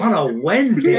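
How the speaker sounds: high, hammed-up disbelief; mock incredulous. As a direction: echo it in baffled exasperation. Retelling incredulous reply to seatmate.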